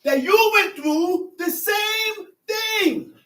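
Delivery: slow emphatic quote. Acted. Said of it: "I said".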